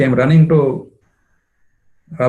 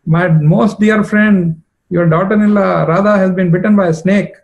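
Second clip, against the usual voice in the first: mock weeping plea; 6 semitones higher, 4 dB louder than usual. Vishakha tells Jatila the bad news, in mock weeping. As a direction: stretch each word like a friend in tears. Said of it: "crying loudly".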